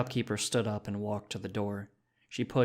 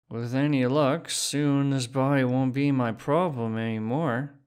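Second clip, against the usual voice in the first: put-on slurring drunk man, higher and louder; a tipsy, careless drunk; half drunk; 4 semitones higher, 6 dB louder than usual. Drunk Phineas laughing off his own body, half drunk. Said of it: "Phineas stumbled in and laughed".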